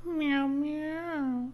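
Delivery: high meowing cat. Acted. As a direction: meow like a cat. The cat answers.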